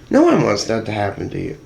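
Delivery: usual speaking voice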